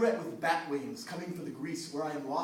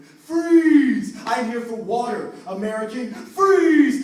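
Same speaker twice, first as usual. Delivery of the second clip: shouting; 7 semitones higher, 15 dB louder, much slower than usual. Acted. Climactic standoff, both voices shouting.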